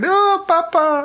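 put-on high, slow little-girl whine; squeaky Asia Argento. Mocking Asia Argento calling for her dad, in a high slow whine. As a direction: coo it up like a wee girl. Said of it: "wee Asia Argento".

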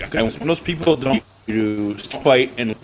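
choppy breaking-up audio; bad connection. Real, not acted. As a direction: voice cutting in and out on a bad line. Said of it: "You're breaking up, dude".

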